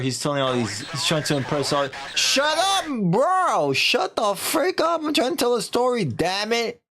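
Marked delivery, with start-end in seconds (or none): 2.1-6.8 high, exasperated yell; irritated outburst. Real, not acted. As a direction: yell at someone to stop interrupting your story. Snaps at someone interrupting the story.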